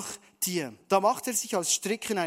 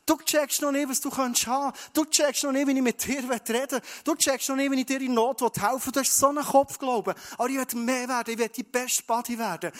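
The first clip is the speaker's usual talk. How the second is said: urgent appeal; 6 semitones higher, 3 dB louder than usual. Acted. Jesus urging the listener.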